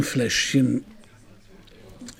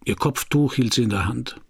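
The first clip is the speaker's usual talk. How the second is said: louder, steady voice-over over music; noir voice-over narration; 2 semitones lower, 6 dB louder than usual. A narrator's reading. Detective recalls meeting Jeanne, voice-over narration. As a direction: narrate it like a hard-boiled detective looking back.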